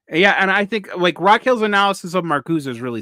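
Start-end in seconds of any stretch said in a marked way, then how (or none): none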